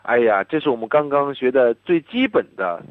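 usual speaking voice

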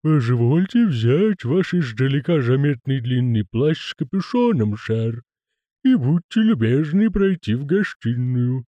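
slow, high creaky lisping servant; creaking groaning door voice. Acted. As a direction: drawl it like a creaky manservant.

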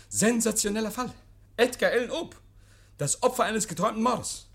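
höher und lauter wie ein Zeitungsjunge, der die Schlagzeile ausruft; hämisch die Sensation ausrufen; spöttischer Zeitungsausrufer. Acted. Oak verkündet spöttisch eine Schlagzeile. (Oak verspottet den Inspektor als Schlagzeilenverkäufer)

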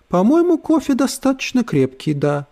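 high, syrupy smiling voice; sweetly smiling. Acted. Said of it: "с благожелательной улыбкой сказала".

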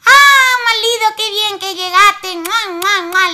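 high, loud delighted welcome; wife overjoyed at husband's return; excited greeting. Acted. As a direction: greet him with joy.

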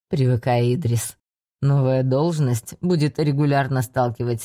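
put-on low, amused old mentor; older man voice. A narrator's reading. Reinard encourages Idris.

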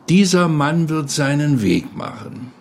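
ordinary talk, nothing marked

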